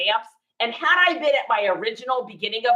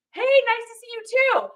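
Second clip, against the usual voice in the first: higher, overly polite greeting; higher, put-on polite hello; awkward polite reply; 10 semitones higher than usual. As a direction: chirp it back nervously. Awkward greeting back to sellers.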